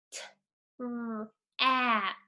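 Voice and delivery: "T-r-ap" split into separate sounds; sounding out trap. Shown as a demonstration. Teacher pointing at letters, sounding out slowly. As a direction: sound out "T-r-ap" one piece at a time. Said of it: "Now let's read the word".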